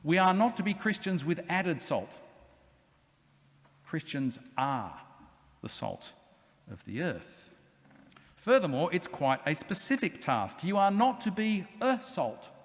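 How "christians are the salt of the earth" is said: slow, much lower and softer, each word weighted; solemn low emphasis. Real, not acted. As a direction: drop the voice low and let "salt" sink. Sermon climax, slowed.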